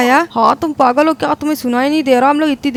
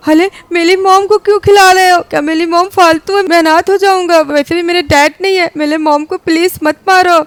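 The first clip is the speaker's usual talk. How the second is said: higher, louder desperate plea; desperate pleading; 4 semitones higher, 5 dB louder than usual. Acted. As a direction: beg the werewolf to spare mom. Son pleads for his mom. (घबराकर गिड़गिड़ाते हुए)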